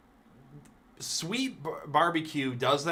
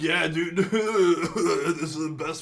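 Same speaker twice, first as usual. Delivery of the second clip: laughing; slower than usual. Retold or imitated. Mock fan quote, laughing.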